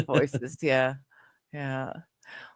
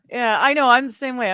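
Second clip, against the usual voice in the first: ordinary talk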